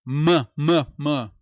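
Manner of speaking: "m, m, m" como mâ, mâ, mâ isolados; som de consoante. Shown as a demonstration. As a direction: repita "m, m, m" em sílabas curtas. ("m, m, m" dito mâ, em golpes)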